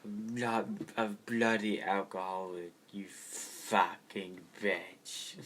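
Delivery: slow, slurring British drunk impression. Riffing on a sitcom's alcoholic uncle, drunken British rocker. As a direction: drag out the curses like a sozzled British rocker. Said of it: "Just turns into fucking [name]".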